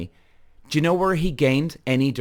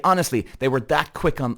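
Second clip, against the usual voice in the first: usual speaking voice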